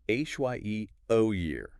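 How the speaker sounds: "H Y E O year" as HI-er-ar-kee; correct stress. Shown as a demonstration. Co-host showing the correct way, first-syllable stress. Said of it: "But the correct way is".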